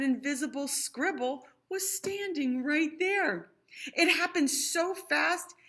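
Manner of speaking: put-on lively read-aloud to children; sing-song rhyming storytelling; storybook reading. A narrator's reading. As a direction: read it like a bedtime picture book. Rhyming picture book read aloud.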